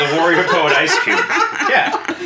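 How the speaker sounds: laughing. Real, not acted. Laughing while saying it.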